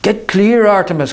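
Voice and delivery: high, loud urgent cry; cried warning. Acted. Holly crying to Artemis, loud and urgent. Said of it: "cried Holly, her voice not her own".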